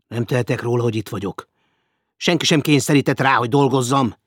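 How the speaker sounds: loud, fast, defiant back talk; a stubborn guest refusing work, loud and quick; defiant retort. Acted. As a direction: fire back quickly without giving ground. Hecker argues with Wolfgang.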